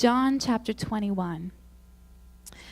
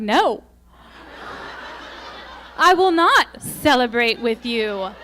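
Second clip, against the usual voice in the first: flat refusal; 5 dB louder than usual. Retold or imitated. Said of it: "And I'm like".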